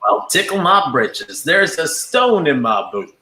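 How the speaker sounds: an Aussie doing a hammed-up American southern accent; Woody southern accent. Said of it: "The only southern accent I think I can do would be Woody from Toy Story".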